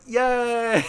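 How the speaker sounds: high, bright mock cheer of relief. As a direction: cheer like you've been let off. Relieved at going back.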